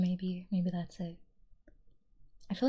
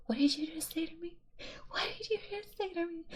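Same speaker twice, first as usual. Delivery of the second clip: high, affronted "what did you say"; mock outrage; 10 semitones higher than usual. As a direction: play it scandalized and squeaky. Mock outrage at maple syrup teasing.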